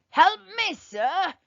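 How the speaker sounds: high, loud plea of the little man; desperate cry. A narrator's reading. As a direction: call out for help in a panic. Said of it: "cried the little man".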